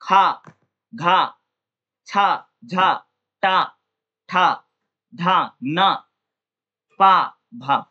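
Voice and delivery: खा, घा, छा, भा said slowly with a strong puff of breath; teacher sounding out aspirated खा, घा, झा; aspirated consonant drills. Shown as a demonstration. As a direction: push extra air on खा and भा, one syllable at a time. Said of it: "उसमें जो हवा है मुख विकार से ज्यादा मात्रा में निकलती है".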